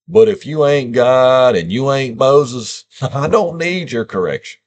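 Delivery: playful defiance; defiant. Real, not acted. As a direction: throw it at the critics. Defiant jab at critics.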